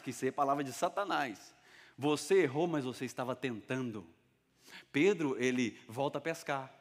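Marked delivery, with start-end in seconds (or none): none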